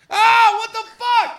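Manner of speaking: high, loud drawn-out cry of pain; shocked scream. Acted. Player whose toe the cat just ate, shocked scream. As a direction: scream as if your toe was bitten off.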